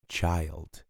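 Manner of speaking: put-on child said slowly with a long vowel; careful child. Shown as a demonstration. Teacher modeling child for students. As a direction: model the English word child. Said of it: "O singular é child".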